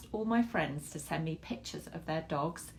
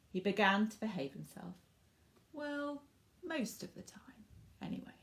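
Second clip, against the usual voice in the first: lively storybook narration for little ones; reading to children; 2 semitones higher, 3 dB quieter than usual. A narrator's reading. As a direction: read it like bedtime story time. Reads picture book to children.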